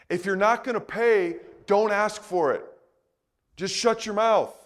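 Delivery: ordinary delivery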